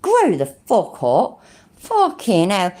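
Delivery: usual speaking voice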